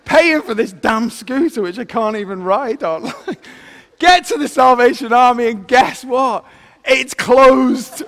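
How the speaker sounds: ordinary delivery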